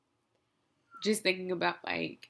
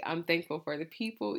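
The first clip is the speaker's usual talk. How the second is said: ordinary delivery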